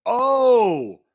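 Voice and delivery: sharp, high and loud "Oh!"; sudden realization. Real, not acted. Host suddenly remembers what's in the video, high excited burst. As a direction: it just hit you, blurt it out loud.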